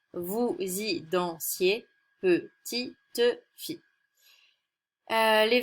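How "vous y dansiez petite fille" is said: slow verse reading. Shown as a demonstration. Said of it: "l'octosyllabe, qui est un vers de huit syllabes".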